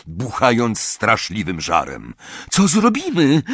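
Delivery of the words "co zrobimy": high, alarmed cry; panicked yell. A narrator's reading. Hermiona trapped by flames, panicked yell. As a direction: cry out over a roar. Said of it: "wrzasnęła Hermiona".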